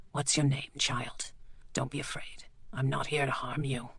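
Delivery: soft, near-whispered comfort; gentle reassurance. Acted. Bleak speaks gently to the frightened girl. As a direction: hush a frightened child.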